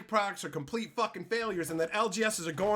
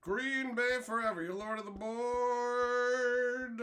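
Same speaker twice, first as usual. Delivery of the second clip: drawn-out announcement; 2 semitones higher, slower than usual. Real, not acted. Slow drawn-out announcement to chat.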